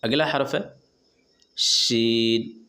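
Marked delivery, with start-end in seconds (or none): 1.6-2.6 شین with a long, slow hushing ش; a sustained sh in شین; sh sound drawn out. Shown as a demonstration. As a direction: stretch شین slowly from mid-tongue. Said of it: "جسے ہم لوگ بڑی شین کہتے ہیں".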